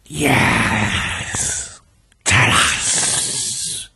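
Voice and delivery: drawn-out whispery hiss; strange hissing voice. A narrator's reading. Dead victims demanding answers from their killer, hissing. Possessed man's hissing voice returns.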